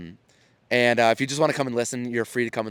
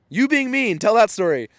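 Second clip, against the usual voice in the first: crowd calling out; 10 semitones higher, 5 dB louder than usual. Retold or imitated. Audience calls out an answer.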